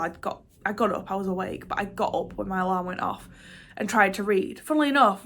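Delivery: ordinary talk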